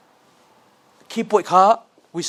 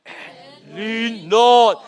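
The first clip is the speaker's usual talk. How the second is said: deep voice; 3 semitones higher, 6 dB louder, much slower than usual. Shown as a demonstration. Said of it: "Deep voice".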